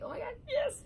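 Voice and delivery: high squealing delight; thrilled. Retold or imitated. Girl retelling getting her crush's Snapchat, high squeal. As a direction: squeal with joy at the news.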